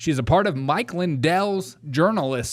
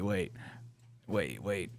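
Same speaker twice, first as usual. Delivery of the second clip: ordinary talk, nothing marked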